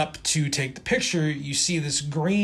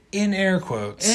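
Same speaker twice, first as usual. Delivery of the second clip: slow, raised, deliberate delivery; slow air quotes; 4 semitones higher than usual. Shown as a demonstration. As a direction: set it apart slowly, as if in quote marks. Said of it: "If I slow down and say something slowly".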